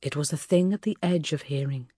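ordinary delivery, nothing marked

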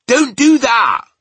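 slow, high, very loud protest; pained yell. A narrator's reading. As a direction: yell it as a hurting giant. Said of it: "yelled the B F G".

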